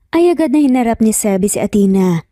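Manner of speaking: ordinary delivery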